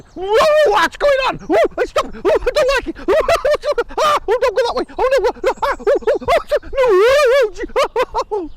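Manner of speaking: very high, loud panicked squeals; terrified high yelling. Acted. Mouse on a bird's back panics, shrieking high. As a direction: yell in a panic, laughing in bits.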